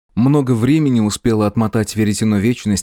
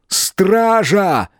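yell; 10 semitones higher than usual. Acted. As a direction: shout for the guards. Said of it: "завопил он".

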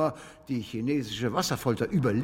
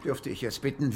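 normal talking voice

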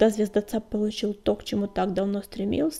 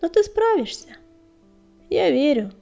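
whispered pep talk; 7 semitones higher, 8 dB louder than usual. Acted. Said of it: "прошептал Огнегриф".

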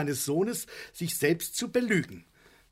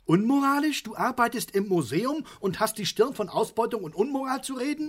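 higher and louder, outraged; indignant outburst; 6 semitones higher, 5 dB louder than usual. Acted. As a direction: throw the word back at him in disbelief. Vater empört über Sohn. (empört, laut und höher)

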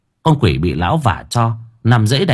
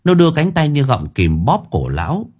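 ordinary delivery

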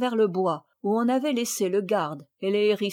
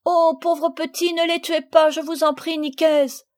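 pleading; 7 semitones higher, 6 dB louder than usual. Acted. Marguerite begging Nicaise to spare the babies. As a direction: beg him, hands clasped. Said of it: "joignant les mains".

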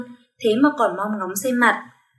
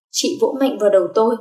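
usual speaking voice

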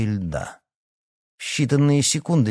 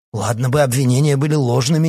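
loud, higher, heated accusation; unrelenting anger; 4 semitones higher, 6 dB louder than usual. A narrator's reading. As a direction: keep pressing angrily. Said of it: "не унимался Аркадий".

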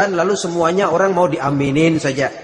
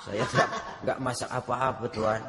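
humble quiet reply; 7 semitones lower, 12 dB quieter than usual. Retold or imitated. Poor mother answers humbly.

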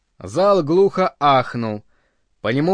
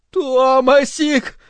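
high, drawn-out wail of a name; anguished plea; 10 semitones higher, 4 dB louder, much slower than usual. A narrator's reading. Linda reaching out to her lover, anguished. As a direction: cry it out slowly, much higher.

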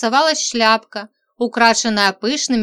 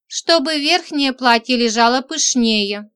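usual speaking voice

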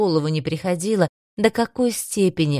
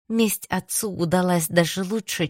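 plain reading voice

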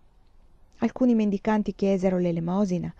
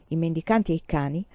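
ordinary delivery

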